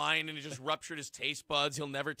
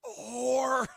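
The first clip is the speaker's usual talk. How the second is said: sharp, higher put-down; mock snapping insult; 6 semitones higher, 3 dB louder, much slower than usual. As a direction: snap it out as a sneer. Acted insult in the taunt.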